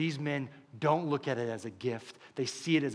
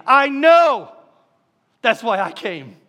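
high ringing shout, louder; shouted climax; 6 semitones higher, 11 dB louder, slower than usual. Acted. Preacher voicing Jesus to the church, a loud ringing shout. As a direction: cry out the promise with full force.

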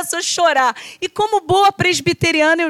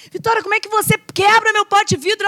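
a touch of mock anger at the daughter; retold angry scolding; 6 semitones higher than usual. Mother scolding little daughter over broken jar, mildly angry. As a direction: scold her half in jest, pitch raised. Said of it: "Eu fiquei uma fera".

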